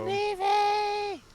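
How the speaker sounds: high, long-drawn yell of a name. Pretend. Player yelling out a name in play, high holler. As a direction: call out high, stretching it slowly. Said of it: "I'm yelling for it".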